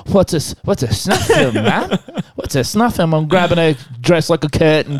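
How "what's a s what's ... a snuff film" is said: put-on curious kid. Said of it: "I'm like".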